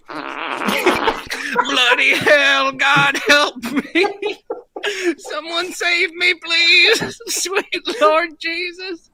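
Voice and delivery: high, drawn-out, panicked cries to God; terrified yelling. Retold or imitated. Friend retelling Dan's night-time panic, a high terrified wail. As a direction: wail for someone to save you. Dan terrified by slamming doors.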